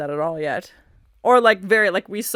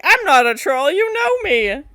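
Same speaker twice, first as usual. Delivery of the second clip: high, loud mock protest; hammed-up troll protest, climbing higher; imitating protesting troll; 8 semitones higher, 7 dB louder than usual. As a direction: plead the troll's case in a raised voice. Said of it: "He's like".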